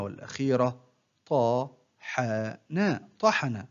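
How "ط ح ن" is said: one short syllable at a time, with pauses; broken into syllables. Shown as a demonstration. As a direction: separate each syllable for a learner to copy. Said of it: "أقطع الكلمة إلى مقاطع قصيرة". (تقطيع الكلمة مقطعًا مقطعًا بوقفات)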